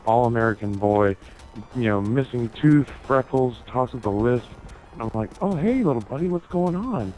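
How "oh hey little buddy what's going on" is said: high, bright customer-service greeting; cheery server voice. Retold or imitated. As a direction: pitch it up like a waiter charming a kid. Greeting the birthday boy cheerily.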